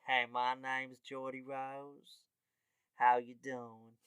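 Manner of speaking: slow put-on American drawl; Geordie Rose. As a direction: introduce yourself as a drawling cowboy charmer. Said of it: "a guy named Geordie Rose".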